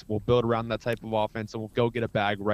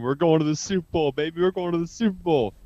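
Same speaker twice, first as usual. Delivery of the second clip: louder, higher mock victory yell; imitating dad's cheer; 6 semitones higher, 4 dB louder than usual. Son imitating his dad cheering the Super Bowl win, loud mock yell. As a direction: shout it like a dad celebrating the win. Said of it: "he screamed".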